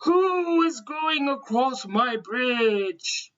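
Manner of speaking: very slow, drawn-out storybook shout; mean troll's shout. A narrator's reading. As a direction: stretch the challenge like a mean troll. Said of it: "the mean troll jumped from under the bridge and shouted".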